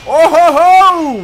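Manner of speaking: loud, slow gleeful ho-ho; gleeful laugh. Real, not acted. Gamer delighted by a weapon, slow loud gleeful laugh. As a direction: cackle with delight.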